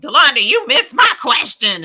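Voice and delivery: loud, high demanding call-out; all-caps yelling. Acted. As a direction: shout it as if typed in capitals. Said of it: "I'm typing it in all caps".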